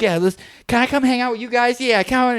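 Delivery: put-on high, pleading kid voice; eager little cousin. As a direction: beg like a tagalong kid. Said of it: "they'd be like".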